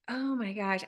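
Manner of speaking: soft, under-the-breath dread; hushed dismay. Retold or imitated. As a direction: retell it as a quiet inward groan. Dreading a phone call, retold.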